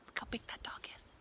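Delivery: hushed awed whisper; breathless whispered awe. Acted. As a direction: whisper it so the dog won't hear. Whispered aside in picture.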